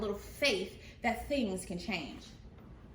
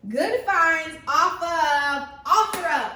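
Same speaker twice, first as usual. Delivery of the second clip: hyped up; 5 semitones higher, 11 dB louder, much slower than usual. Real, not acted. Hyping her OfferUp finds.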